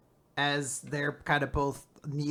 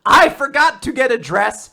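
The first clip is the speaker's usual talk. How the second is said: mock indignant protest; 10 semitones higher, 13 dB louder than usual. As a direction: imitate a flustered, outraged denial. Acted Latla protesting accusation.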